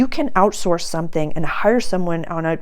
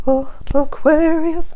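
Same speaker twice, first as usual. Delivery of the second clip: surprised exclaim; 11 semitones higher, 6 dB louder, slower than usual. Real, not acted. Reacts to drawn card.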